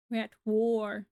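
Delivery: roaring rallying speech. Pretend. Mock battle speech.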